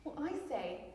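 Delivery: usual speaking voice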